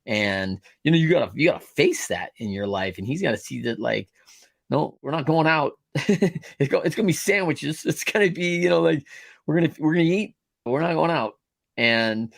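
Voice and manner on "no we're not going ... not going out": slightly hammed-up parent, pitched higher; mock parent refusal. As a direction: act out a parent laying down the rule. Voicing a parent to kids.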